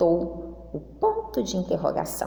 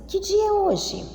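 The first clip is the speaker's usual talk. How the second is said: "Que dia é hoje?" pitched much higher as a clear asking question; question intonation; 14 semitones higher than usual. Shown as a demonstration. Teacher demonstrates question intonation to the children, pitched high. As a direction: lift the whole question up high to show it asks. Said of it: "eu pergunto assim".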